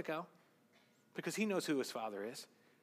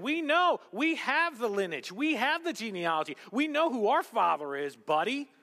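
smug mock boast; 7 semitones higher, 6 dB louder than usual. Mocking Pharisees' boast to Jesus.

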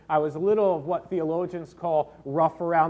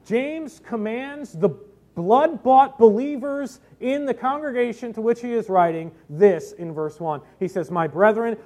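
loud, raised, emphatic scripture reading; forceful preaching; 6 semitones higher, 9 dB louder than usual. Real, not acted. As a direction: proclaim the verse with weight, stretching key words. Preacher declaims the Bible verse.

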